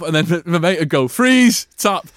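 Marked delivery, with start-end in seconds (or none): 1.1-2.1 high-pitched mock wrestling call, building; gleeful wrestling interruption. Mate turning drama into wrestling, gleeful. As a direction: burst in and leap up in pitch.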